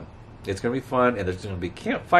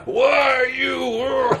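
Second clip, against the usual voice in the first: mock shout at drivers; 12 semitones higher, much slower than usual. Real, not acted. Yelling at honking drivers outside.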